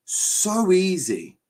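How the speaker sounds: slow, high scornful drawl. Real, not acted. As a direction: stretch it out with contempt. Scorning United's attack.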